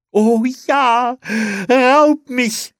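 high, loud, drawn jubilant cry; jubelnd. A narrator's reading. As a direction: cheer with delight at full voice. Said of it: "jubelte Olli". (laut jubelnd)

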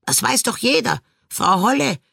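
high, cawing know-it-all voice; two ravens crowing in chorus at the fairy. A narrator's reading. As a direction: crow it out like a smug raven. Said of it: "krähten die beiden Raben im Chor".

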